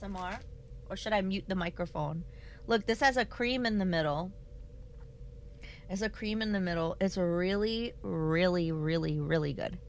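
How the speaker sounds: quiet. Real, not acted. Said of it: "Do you need the crunch ASMR".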